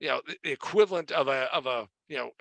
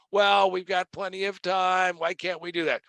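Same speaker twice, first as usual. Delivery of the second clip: imitating a whiny coworker's protest; mock whine, insistent and higher; whining; 4 semitones higher than usual. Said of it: "and he is whining".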